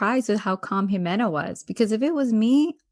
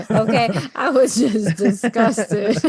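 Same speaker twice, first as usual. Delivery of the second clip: laughing; 6 dB louder, slower than usual. Real, not acted. Laughing about the slap.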